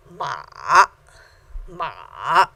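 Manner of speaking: MA low, dipping, then rising; full third tone. Shown as a demonstration. Teacher demonstrating full third tone, dipping-rising MA. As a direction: drop MA to the bottom, then bring it back up. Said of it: "this is a full third tone".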